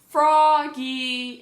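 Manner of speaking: loud, long-held call; drawn-out storybook summons; father calling out. A narrator's reading. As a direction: holler the name through the house. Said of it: "called his father".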